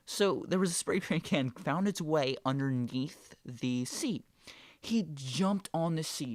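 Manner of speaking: ordinary talk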